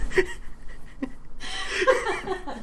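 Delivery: real burst of high, loud laughter; laughing hard. Real, not acted. Streamer reacting to being kidded with a surprise, laughing hard. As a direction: crack up on a drawn-out exclamation.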